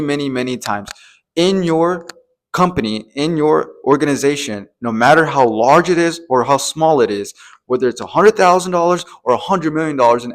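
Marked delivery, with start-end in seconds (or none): none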